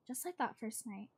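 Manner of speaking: usual speaking voice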